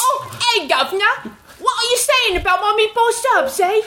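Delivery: high, hammed-up Cockney accent; Mary Poppins Cockney. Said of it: "moved on from Mary Poppins".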